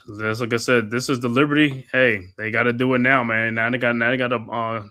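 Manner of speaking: normal talking voice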